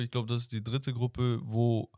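ordinary talk

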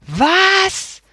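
sharply high, stunned question; can't believe it; shocked. A narrator's reading. Boy hearing the parents forgot the birthday, shocked. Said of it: "Ben konnte das gar nicht glauben".

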